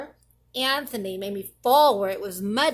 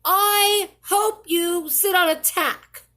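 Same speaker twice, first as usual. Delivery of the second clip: higher and louder, a taunt; spiteful retort; 8 semitones higher, 6 dB louder than usual. Acted. Child snapping at friend Paul, spiteful and loud. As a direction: fire it back at Paul. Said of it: "I said to Paul".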